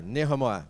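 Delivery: Nehemiah said as neh-huh-MY-ah; short 'neh', light 'huh' in Nehemiah; correct pronunciation. Shown as a demonstration. Preacher getting back on track, Nehemiah carefully said as neh-huh-maya. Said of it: "but it's Nehemiah".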